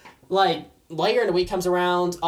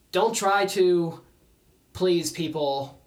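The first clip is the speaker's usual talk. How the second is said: soft, lower, measured with long pauses; calm, firm counsel; 4 semitones lower, 6 dB quieter, slower than usual. Retold or imitated. Mentor advising against pleasing people, quietly sincere. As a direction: let each phrase land gently and slowly.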